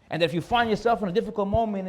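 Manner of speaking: ordinary talk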